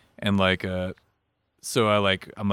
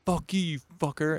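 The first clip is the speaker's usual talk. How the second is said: high-pitched insult, not shouted; mock taunt; 8 semitones higher, 4 dB quieter than usual. Older boy taunting the kid behind, high softened mock taunt. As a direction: jeer it high, with little force. Retold giggling taunt between schoolboys.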